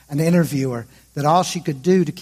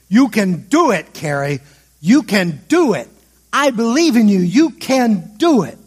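loud, higher encouraging call across the gym; coach calling out; 4 semitones higher, 6 dB louder than usual. Retold or imitated. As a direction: cheer her on from the sidelines, no real accent. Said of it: "in his thick Romanian accent, calling out to Carrie".

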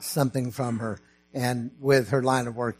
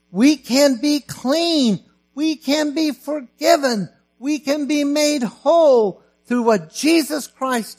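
very high, loud, slow proclamation; peak preaching; 11 semitones higher, 6 dB louder than usual. Acted. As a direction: ring out every word of salvation. Preaching salvation at peak intensity.

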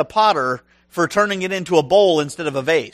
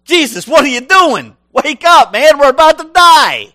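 much higher, loud frantic shout; panicked yell; 9 semitones higher, 12 dB louder than usual. Retold or imitated. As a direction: yell him awake, sure the boat is sinking. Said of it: "that sounds more like Peter".

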